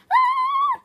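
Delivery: very high, loud delighted squeal; excited squeal. Real, not acted. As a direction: squeal with glee. Squeal at unboxing plushie.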